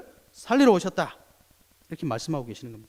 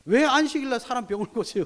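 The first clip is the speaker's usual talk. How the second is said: higher indignant protest; mock protest; 8 semitones higher than usual. (분개한 항의 흉내)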